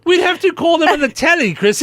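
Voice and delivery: playful put-on British lilt; mock British accent. Teasing Chrissy in a mock British accent. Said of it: "we must do it in a British accent".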